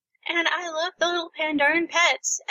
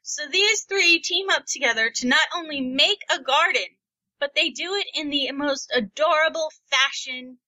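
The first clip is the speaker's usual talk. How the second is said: ordinary talk, nothing marked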